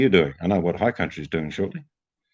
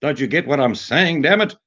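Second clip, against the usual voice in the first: much higher and louder, spelling it out to a foreigner; mock Brit abroad shouting his order; loud British tourist; 8 semitones higher than usual. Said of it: "they just talk louder".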